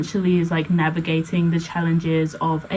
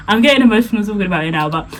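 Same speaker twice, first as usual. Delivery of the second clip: getting emotional; 3 semitones higher, 6 dB louder, much faster than usual. Real, not acted.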